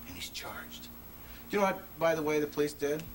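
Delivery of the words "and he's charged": a secret slipped in a whisper; whispered aside. Real, not acted. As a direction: whisper it under the breath. Aside on the mother's ignorance.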